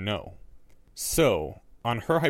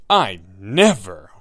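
loud, slow outraged protest; indignant; 3 semitones lower, 10 dB louder, much slower than usual. A narrator's reading. Harry rising dramatically at Neville, loud indignant protest. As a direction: draw it out in mock outrage. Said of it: "voice full of indignation".

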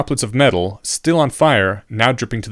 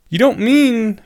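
high-pitched, hesitant dismay; dawning disbelief; 12 semitones higher, 3 dB louder, slower than usual. A narrator's reading. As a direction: raise the voice in appalled disbelief. Student reacting with dismay.

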